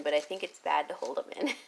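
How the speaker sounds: quick whispered aside; whispering. Real, not acted. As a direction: whisper it fast to yourself. Aside while holding in hiccups.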